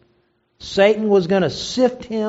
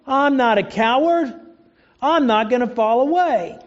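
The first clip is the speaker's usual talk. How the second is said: higher, proud boasting; boastful Peter; 6 semitones higher than usual. Retold or imitated. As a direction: puff up like a cocky Peter. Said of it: "Peter thought".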